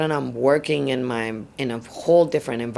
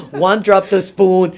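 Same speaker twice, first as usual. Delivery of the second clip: retold yell; 7 semitones higher, 10 dB louder, slower than usual. Yelling at kids retold.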